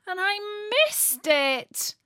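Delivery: put-on high, drawn-out childish wail. A narrator's reading. Natalie learning she missed Christmas, wailing. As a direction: wail it, stretching the words out. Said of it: "wailed Natalie".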